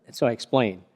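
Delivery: usual speaking voice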